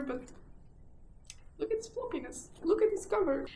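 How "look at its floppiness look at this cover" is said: excited. Real, not acted. Showing off a floppy book.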